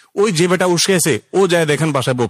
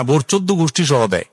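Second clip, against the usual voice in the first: ordinary talk, nothing marked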